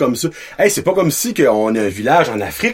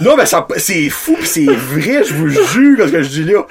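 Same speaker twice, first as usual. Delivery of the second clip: higher, laughing through the words; laughing, amazed at the story; laughing disbelief; 4 semitones higher, 4 dB louder than usual. Real, not acted. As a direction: laugh while telling it, amazed. Laughing over absurd story.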